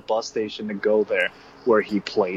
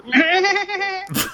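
high, slow bleating goat. Pretend. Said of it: "that's a pretty good goat".

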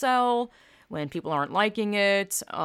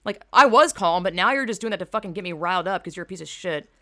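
riled-up imagined comeback; furious mock reply; angry retort; 4 dB louder than usual. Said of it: "where it's like".

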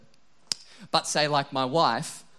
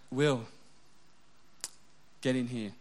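stern quieter call; 4 semitones lower, 10 dB quieter than usual. Retold or imitated. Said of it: "the quieter it gets, the more scared I get".

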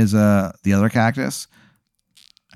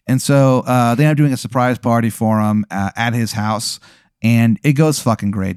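ordinary talk

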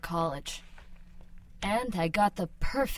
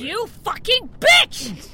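slow, much higher, loud scream of rage; furious shout; 14 semitones higher, 10 dB louder, slower than usual. Acted. Woman insulted by partner, furious shout. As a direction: scream it at him.

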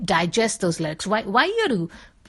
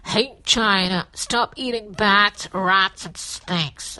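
pinched nose; much slower than usual. Shown as a demonstration. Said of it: "I'm shutting my nose, I'm saying this".

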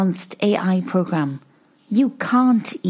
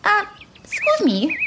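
polite high voice; 10 semitones higher than usual. Acted.

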